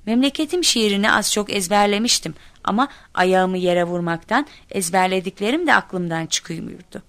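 ordinary delivery

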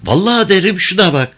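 put-on high-pitched girl threatening to tell; high young voice, pert and unyielding; defiant village girl. A narrator's reading. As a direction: threaten him in a girl's high, insistent voice.

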